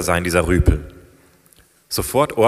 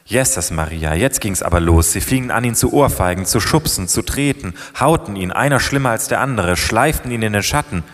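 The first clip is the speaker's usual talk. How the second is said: ordinary delivery, nothing marked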